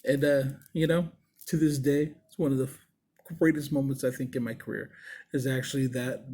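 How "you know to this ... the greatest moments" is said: ordinary delivery, nothing marked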